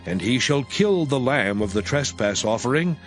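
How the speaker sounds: plain reading voice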